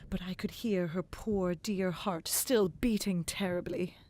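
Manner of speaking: usual speaking voice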